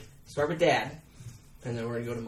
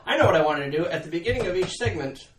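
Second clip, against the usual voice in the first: normal talking voice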